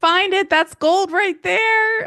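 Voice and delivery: high, loud excited demand; playful insistence. Pretend. Host backing coach about the lost episode, playfully insistent. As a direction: pile on with mock urgency, pitch way up. Host joins the mock outrage, urging.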